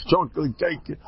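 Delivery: ordinary talk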